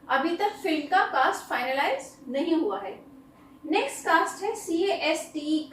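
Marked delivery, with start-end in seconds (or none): none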